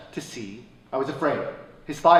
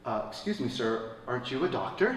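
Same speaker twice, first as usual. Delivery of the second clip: 1 meek bystander; 4 semitones lower, 9 dB quieter than usual. Retold or imitated. Bystander voiced meekly.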